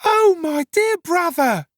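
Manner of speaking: very high, slow, loud wail; loud scream. Acted. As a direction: scream it out like a distraught sister. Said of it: "screaming out very loud".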